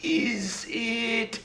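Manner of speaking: high, soft, hesitant guess; drawn-out high princess voice. Acted. Princess guesses the imp's name, high soft acted voice. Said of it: "And she guesses".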